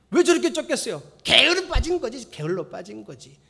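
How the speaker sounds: high, loud mock scolding. Pastor mocking his own belly with loud scolding. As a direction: scold yourself in jest. Mock accusing himself of laziness.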